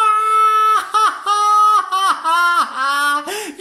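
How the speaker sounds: high, loud breathless laughter; breathless laughing. Real, not acted. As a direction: laugh until the breath runs out. Laughter while cutting. (fou rire essoufflé)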